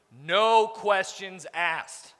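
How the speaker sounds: drawn out very slowly; slow emphatic repeat. Shown as a demonstration. Boss warning his staff, each word very slowly. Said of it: "repeated for emphasis".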